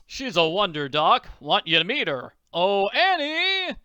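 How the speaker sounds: loud boast rising to a high call; proud calling out. Acted. Father calling the girl in to meet the doctor, proud. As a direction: brag loudly, then holler for the girl.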